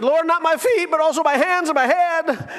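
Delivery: high, loud, gushing plea to wash everything; all-in enthusiasm with no brakes; overeager Peter. Acted.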